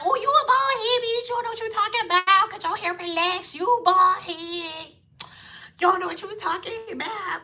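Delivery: high, mocking voice of her haters; mocking critic voice. Vlogger mocking the people who called her bald, high and sneering. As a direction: sneer it like a petty online hater.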